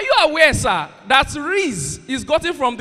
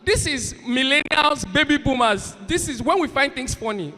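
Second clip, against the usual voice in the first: normal talking voice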